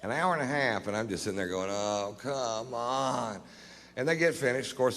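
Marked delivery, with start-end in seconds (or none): 1.7-3.4 slow, drawn-out, fed-up sigh of a line; long-suffering mock complaint; weary groan. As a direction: let it sag with boredom. Bored waiting on the plane search.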